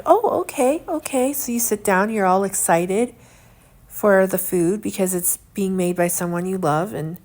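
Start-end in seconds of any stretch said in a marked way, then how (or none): 0.0-1.3 mock eager delight. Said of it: "And you're like".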